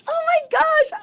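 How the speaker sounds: very high, loud gushing; excited squeal. Retold or imitated. Herself being a little over at the gym, excited squeal. Acting out her excitable personality.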